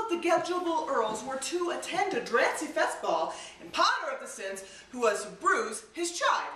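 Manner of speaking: "Tunce upon a whine" with first letters swapped throughout; spoonerism. Shown as a demonstration. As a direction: tell "Prinderella" with onsets of word pairs switched. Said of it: "Here I go".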